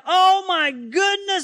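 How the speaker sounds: high, loud and slow, overwhelmed with awe; astonished gasp. Acted. Manoah and his wife see the angel ascend, astonished cry. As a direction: cry out in amazement.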